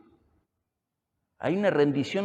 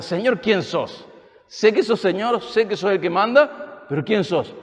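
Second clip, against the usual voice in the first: Paul pleading to know; 5 semitones higher, 5 dB louder than usual. Retold or imitated. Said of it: "Es la primera pregunta".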